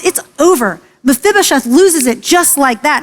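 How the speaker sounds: ordinary talk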